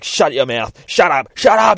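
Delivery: loud, rising demand for silence; angry shouting. Retold or imitated. Junkie with gun silencing a taunting woman, angry shouting. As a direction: build to a high, loud shout.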